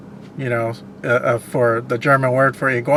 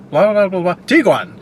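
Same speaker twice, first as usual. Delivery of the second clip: higher mock announcer, punching the name at the end; imitating foreign car ad; 5 semitones higher than usual. Retelling a Polish TV car ad, mock announcer. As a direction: gibberish patter, then land the name like an ad. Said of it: "it's like".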